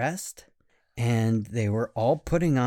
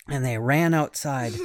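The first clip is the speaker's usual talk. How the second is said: ordinary delivery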